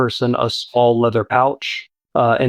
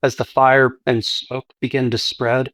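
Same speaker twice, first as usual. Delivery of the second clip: normal talking voice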